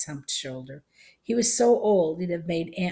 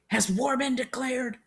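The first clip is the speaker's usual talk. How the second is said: put-on high old-man voice; pitched-up elderly question; alarmed old soldier; 8 semitones higher than usual. A narrator's reading. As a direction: ask it like an old soldier roused to fight. Old soldier alarmed, waving fists.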